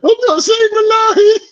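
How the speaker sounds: very high, laughing squeak; high squeaky mechanic. Retold or imitated. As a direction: squeal it like a scared man. Mechanic refusing, mimicked.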